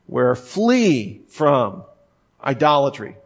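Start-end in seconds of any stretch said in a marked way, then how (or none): 0.3-1.2 FLEE drawn out long with a high, falling swoop; emphatic language. Retold or imitated. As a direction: lean hard on "flee", stretching the vowel. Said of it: "They use emphatic language".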